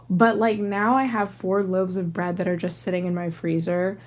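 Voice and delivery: ordinary talk, nothing marked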